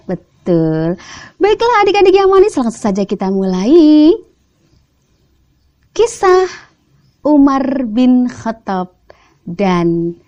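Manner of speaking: ordinary delivery, nothing marked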